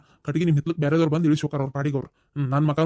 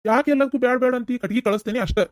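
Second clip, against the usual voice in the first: pitched-up exasperated demand; exasperated insistence; 8 semitones higher than usual. Acted. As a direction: push back hard, frustrated. He insists after repeated refusals.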